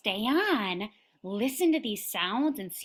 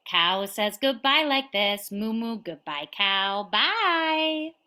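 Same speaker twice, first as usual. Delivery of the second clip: ordinary talk, nothing marked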